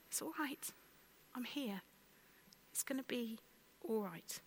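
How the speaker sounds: soft, tender it's all right; gentle soothing whisper of comfort; kind reassurance. Shown as a demonstration. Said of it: "a different way of saying".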